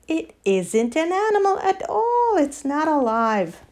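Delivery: high, lightly voiced protest; protesting animals. Animals arguing it is no animal, protesting. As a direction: insist on it, pitch swooping up.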